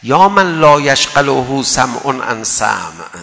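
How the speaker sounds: slow, loud Arabic recitation; solemn recitation. Acted. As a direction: intone the tradition with weight. (تلاوت آرام و با طمأنینه)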